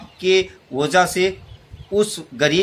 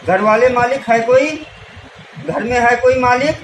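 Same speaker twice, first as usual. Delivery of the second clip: louder call to the house; raised voice at the gate; 4 semitones higher, 5 dB louder than usual. Acted. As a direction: call out to the master from outside. Calling out at a rich man's door.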